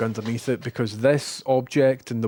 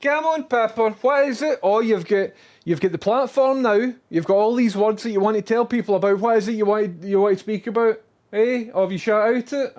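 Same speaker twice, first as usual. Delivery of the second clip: teasing pet talk; 7 semitones higher, 4 dB louder than usual. Real, not acted. Teasing the dog.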